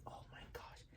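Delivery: breathless whispered gasp of panic; horrified whisper. Retold or imitated. Officiant realizing she forgot to seat guests, whispered. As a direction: hiss it under your breath, caught out. Panicked self-talk after forgetting.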